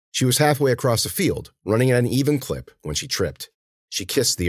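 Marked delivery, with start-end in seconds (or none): none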